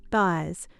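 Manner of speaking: plain reading voice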